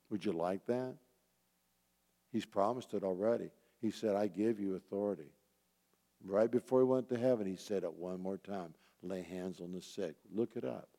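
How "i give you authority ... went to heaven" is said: usual speaking voice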